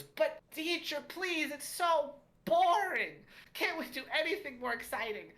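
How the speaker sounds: very high, whining halfling pupil; Drynan. Acted. Bored pupil begs the teacher for something exciting, high whine. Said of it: "that same halfling, um, named Drynan goes".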